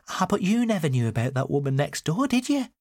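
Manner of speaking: high-voiced mother springing news; spring it on them, pitch climbing at the end; high, knowing challenge; mother's revelation. A narrator's reading.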